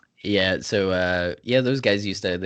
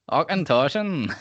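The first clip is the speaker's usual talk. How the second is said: high, drawn-out mock Scottish; exaggerated Scottish accent; 7 semitones higher, slower than usual. Shown as a demonstration. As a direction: stretch it out in playful Scottish. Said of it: "try and say it Scottish".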